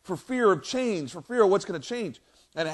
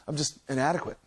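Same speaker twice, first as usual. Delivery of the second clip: soft, low admission of inadequacy; self-doubting; 6 semitones lower, 8 dB quieter than usual. Acted. Us looking at ourselves, quietly doubting.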